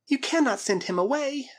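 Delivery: high-pitched distressed protest; girl's cry. A narrator's reading. Girl protests Karl being sent away, crying out high. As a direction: cry out against it, voice way up. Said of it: "cried the girl".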